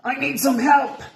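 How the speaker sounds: high, loud cry for help; calling for help. A narrator's reading. Quasi straining on the tail, high loud call for help. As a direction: yell for help while straining.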